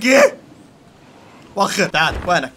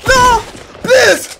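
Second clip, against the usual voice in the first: high, loud pleading shout; desperate plea; 13 semitones higher, 6 dB louder, much slower than usual. Real, not acted. Gamer cornered in a fight, loud desperate plea. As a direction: beg loudly for mercy.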